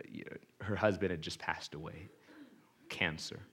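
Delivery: ordinary delivery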